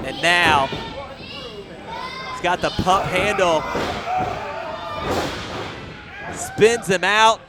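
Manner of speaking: excited ringside call; wrestling commentator. Real, not acted. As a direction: call each big move loud. Live wrestling play-by-play, big spots.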